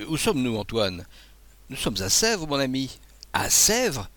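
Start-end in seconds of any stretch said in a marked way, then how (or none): 3.3-4.1 surprise. Acted. Abbé alarmé par l'heure.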